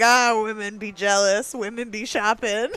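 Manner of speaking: loud mocking quote through laughter; teasing a friend's meme posts, laughing. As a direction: laugh while parroting a tired joke. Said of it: "You're like".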